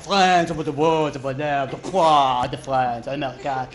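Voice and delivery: lightly hammed-up French accent, slow; mock French accent. A Frenchman brushing off the lost American, in a mock French accent. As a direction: mumble it like a dismissive Frenchman. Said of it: "It's like".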